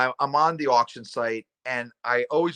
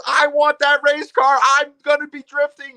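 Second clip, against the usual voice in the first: put-on little boy wanting the race car; child voice; 12 semitones higher, 5 dB louder than usual. Wyatt begging dad for the race car, high child voice. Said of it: "He goes".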